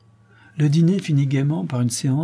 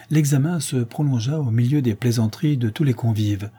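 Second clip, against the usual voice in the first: ordinary delivery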